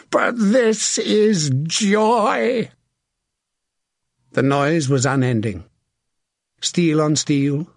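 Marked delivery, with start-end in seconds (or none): 0.1-2.7 very slow, high, stretched-out shout; exultant shout. A narrator's reading. Sir John revelling in the slaughter with Sir William, shouting. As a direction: roar your joy across the battlefield. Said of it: "Sir John shouted".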